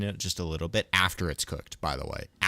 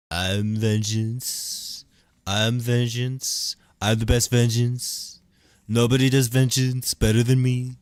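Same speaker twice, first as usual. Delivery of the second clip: slow, rising, louder put-on boast; Trump-style vengeance boast; 5 semitones higher, 7 dB louder, slower than usual. Streamer mashing Trump with a movie hero, loud boastful impression. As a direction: stretch it out and build to a loud brag. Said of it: "Italian Trump".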